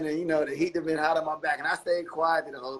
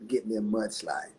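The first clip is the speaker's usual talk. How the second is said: murmured low and slow, imitating a cool star; retold whisper; 8 semitones lower, 6 dB quieter than usual. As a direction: drop it down and draw it out like a quiet confidence. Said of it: "so he whispers to my".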